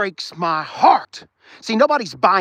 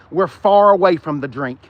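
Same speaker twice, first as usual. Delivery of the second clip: ordinary delivery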